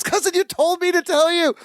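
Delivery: high-pitched mock defensive protest. Imitating Balky defending himself to Larry, protesting. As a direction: plead your case, high and put out.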